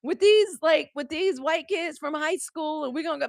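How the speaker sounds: put-on high, eager kid asking mom's permission; a teen girl pleading with her mom, high and eager; my own teen voice to mom. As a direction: pitch it way up like a hopeful teenager. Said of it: "The idea".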